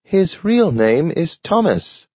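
high voice, slow and careful; a dog explaining who Grandpa is; talking dog, slow. A narrator's reading. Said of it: "said Nathaniel".

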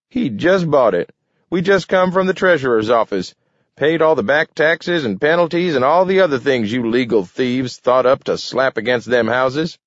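put-on high gloating old-timer; feisty old man rubbing it in. A narrator's reading.

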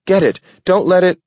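high, loud urgent cry, cut off; shouting. A narrator's reading. Dog ordering the wardens to grab it, shouting. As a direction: yell the order before it escapes. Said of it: "he shouted".

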